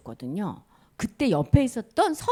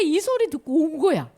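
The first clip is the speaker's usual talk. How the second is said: normal talking voice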